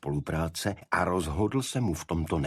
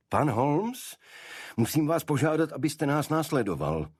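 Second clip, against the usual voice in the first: higher, firm voice of the big visitor; tall man's summons; 8 semitones higher than usual. A narrator's reading. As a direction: politely command Holmes to come along. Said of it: "pokynul detektivovi ten nejvyšší z nich". (vyšší hlas urostlého muže, zdvořilý příkaz)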